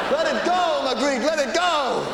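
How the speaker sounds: high, loud pleading cry; mock pleading shout. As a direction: beg him to let it go, way up high. Mock plea to painter.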